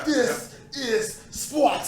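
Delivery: huge slow high shout, laughing; Sparta shout. Retold or imitated. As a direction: bellow it like a Spartan king, cracking up. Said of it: "What that sound like".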